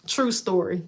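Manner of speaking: ordinary delivery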